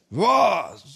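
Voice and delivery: high, loud shocked cry; father's shocked outburst; shocked what. Retold or imitated. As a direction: blurt it in disbelief.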